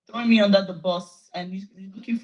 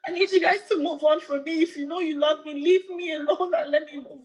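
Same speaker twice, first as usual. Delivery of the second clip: high-pitched, tearful begging; crying plea; 8 semitones higher than usual. Real, not acted. Woman pleading with her followers to leave her alone, crying. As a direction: beg to be left alone, really crying. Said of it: "laughing at [name] crying".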